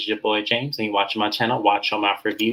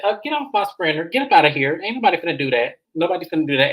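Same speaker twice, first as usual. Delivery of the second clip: higher, sassy brush-off; mock sassy dismissal; 6 semitones higher, faster than usual. [name] ordering Ashley off her sprinter, sassy. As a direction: shoo her out of the van with attitude.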